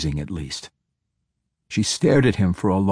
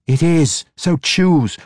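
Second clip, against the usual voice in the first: loud, high-pitched demand; forceful insistence; 10 semitones higher, 5 dB louder, slower than usual. A narrator's reading. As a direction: push for an answer, voice raised. Captor insists in heated exchange.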